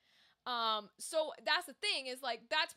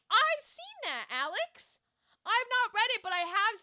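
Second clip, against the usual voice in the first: excited squeal; 8 semitones higher, 7 dB louder than usual. Real, not acted. Excited reply to chat.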